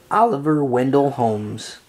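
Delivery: plain reading voice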